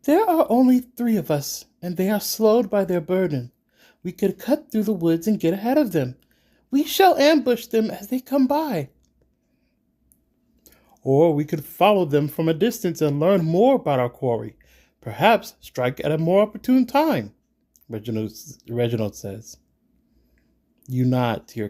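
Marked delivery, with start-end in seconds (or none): 0.0-8.9 loud, high-pitched insistence; forceful urging. A narrator's reading. Siba urging companions to ambush, forceful. 11.0-17.3 raised, higher-pitched counterproposal; arguing. A narrator's reading. Reginald proposing to follow the quarry, insistent. Reginald argues against the ambush.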